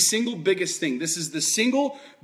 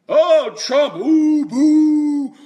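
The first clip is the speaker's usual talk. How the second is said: higher, louder hammed-up boos; mock booing crowd; 8 semitones higher, 8 dB louder, much slower than usual. Debate crowd booing Trump, imitated as loud hammed-up jeering. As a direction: jeer like a mocking audience.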